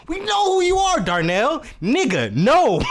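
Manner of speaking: busting the robber loudly, high and incredulous; loud call-out. Retold or imitated. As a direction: yell it so he knows he's caught. Calling out the disguised robber.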